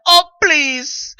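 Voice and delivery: loud, high scoff, waving it off; dismissive scoff. Retold or imitated. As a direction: sneer it like a fed-up mother. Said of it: "Her mother was like".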